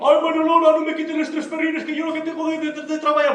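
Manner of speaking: súplica aguda y asustada; temblando de miedo. Acted. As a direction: ruega con la voz muy alta y temblorosa. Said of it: "empezó a temblar. Pero de miedo". (Xico suplica aterrado al ladrón, voz muy aguda)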